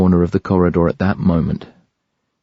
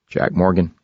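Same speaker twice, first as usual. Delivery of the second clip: put-on West Coast accent. A narrator's reading. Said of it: "smooth, unmistakably West Coast accent".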